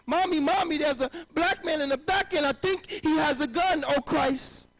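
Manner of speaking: put-on high, frantic little girl scream. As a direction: shriek it like a scared child.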